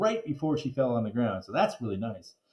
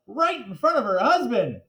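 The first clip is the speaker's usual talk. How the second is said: ordinary delivery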